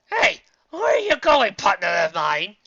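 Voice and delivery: loud, slow, high opening; cartoon scientist's needy protest; mad scientist calling out. A narrator's reading. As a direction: call after your partner, drawing it out. Scientist calls after departing partner.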